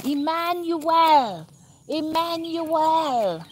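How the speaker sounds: stretched-out high hollered Emmanuel, imitating fervent worshippers; mock worshipper hollering. Mocking Africans in church, a slow high drawn-out holler. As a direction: draw out Emmanuel slow and high like a congregation crying out. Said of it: "They're hollering".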